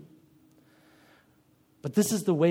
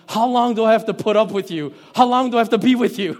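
higher, louder, exasperated sigh of a question; fed up, building to a near laugh; exasperated Jesus; 7 semitones higher, 10 dB louder, faster than usual. Retold or imitated. Preacher voicing Jesus fed up with his disciples, exasperated. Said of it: "there is this sigh that Jesus lets out going".

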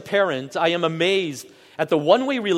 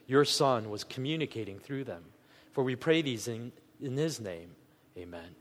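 softer, lower, hushed prayer voice; 3 semitones lower, 7 dB quieter than usual. Acted. Pastor praying for understanding before the sermon, hushed and humble. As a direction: pray quietly and humbly, letting lines fade.

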